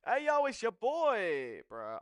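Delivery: loud, slow hype-man intro; streamer greeting impression. Streamer opening his stream to his chat, mock hype intro. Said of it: "Let's go, Ali Dawa".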